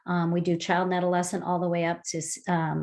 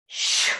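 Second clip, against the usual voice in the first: long sh breath out. Shown as a demonstration. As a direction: push the breath out through the teeth as shh. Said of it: "One more time".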